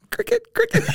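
high chirpy cricket sound; cricket imitation. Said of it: "sound effect of a cricket".

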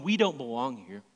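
ordinary talk, nothing marked

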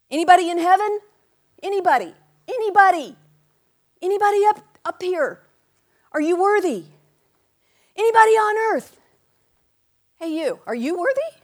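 high, loud searching call. Acted. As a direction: shout it out to the whole room. Calling out across heaven.

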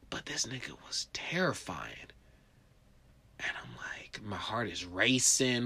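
hushed terrified telling; fearful whisper. Retold or imitated. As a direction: whisper like you're still scared. Terror recalled.

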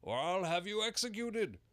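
high, loud angry king. A narrator's reading. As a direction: threaten him furiously. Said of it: "the king repeated angrily".

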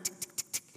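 imitated ticking of bike pedals; soft, voiceless tongue ticks; clicking pedals. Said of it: "it just clicks".

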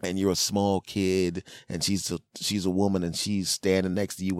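normal talking voice

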